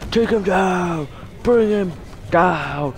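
high-pitched, stretched-out battle cry; excited urging. Real, not acted. Urging on a takedown in game.

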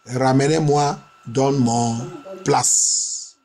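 normal talking voice

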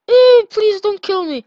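high, loud frantic plea; panicked begging. Real, not acted. Player begs a chasing cell not to eat him, high panicked plea. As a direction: beg for your life in a squeak. Pleading while chased.

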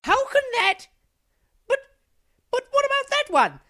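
high, sputtering disbelief; bewildered protest. Acted. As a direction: protest in rising confusion. Protests about the missing wall.

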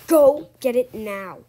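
loud furious order; angry shouting. Acted. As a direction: yell it at the kid. Babysitter yelling order.

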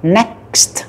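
Next ending in a clear K, S and T; final cluster KST. Shown as a demonstration. Teacher modelling Next's final consonants for students. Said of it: "Âm K, âm S và âm tở ha".

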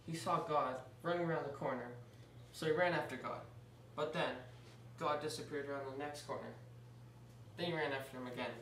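ordinary delivery, nothing marked

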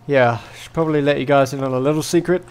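ordinary delivery

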